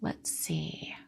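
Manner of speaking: hushed muttering to herself; soft unvoiced murmur while reading comments; whispered aside. Real, not acted. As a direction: whisper it under your breath while scanning.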